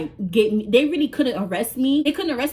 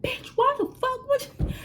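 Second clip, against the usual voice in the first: high, mock furious cussing; cursing him out; 3 dB quieter than usual. Scolding boyfriend, high mock fury. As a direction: chew him out in a shrill-free high cursing voice. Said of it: "I'm cursing him".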